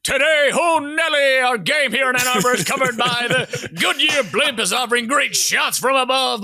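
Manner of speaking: loud, high sportscaster bellow; Brent Musburger impression. Podcaster mimicking a college football announcer, loud and high. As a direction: boom it out like a game-day broadcaster. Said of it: "Brent Musburger ... where he's like".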